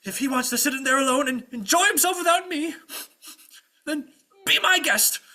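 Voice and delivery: high, loud wounded outrage; ranting. Acted. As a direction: build the grievances into a storming exit. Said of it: "he keeps on ranting".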